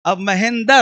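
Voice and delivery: normal talking voice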